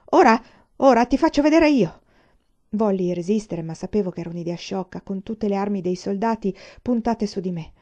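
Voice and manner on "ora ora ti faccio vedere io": loud, raised-pitch threat; angry yelling. A narrator's reading. Furious sergeant shakes the student on the desk, yelling. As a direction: yell it in a fury. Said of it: "gridando ancora".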